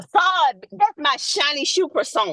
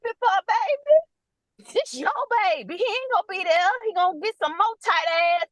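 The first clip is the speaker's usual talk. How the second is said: put-on pitched up in disbelief on "baby"; an exasperated auntie asking what there is to be happy about; incredulous scolding; 7 semitones higher than usual. Nurse scolding a happy pregnant girl, incredulous and higher. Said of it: "And I'm like".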